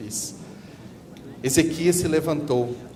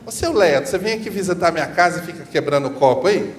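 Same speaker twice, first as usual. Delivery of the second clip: higher, louder mock reproach; mock scolding a guest; 4 semitones higher, 7 dB louder than usual. Host scolding a visiting adult who breaks a glass, mock reproach. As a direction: chide an adult guest, half in jest. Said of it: "a gente fala a mesma coisa".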